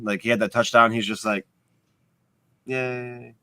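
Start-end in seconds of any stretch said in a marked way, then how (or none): 2.7-3.3 soft, deadpan mock cheer; a cheer with no excitement at all; unenthusiastic yay. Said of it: "Josh still didn't really show emotion".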